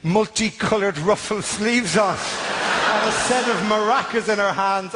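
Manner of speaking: mock trailer voice. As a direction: narrate like a film trailer. Cinematic trailer narration.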